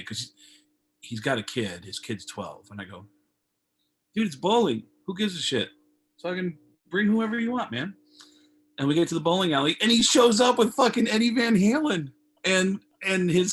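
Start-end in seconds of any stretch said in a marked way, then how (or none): 4.1-7.9 breezy dismissal. Retold or imitated. Brushing off the kid worry.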